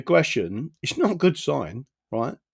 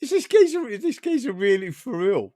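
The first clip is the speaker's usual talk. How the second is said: disbelieving; 9 semitones higher than usual. Retold or imitated. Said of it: "I was like".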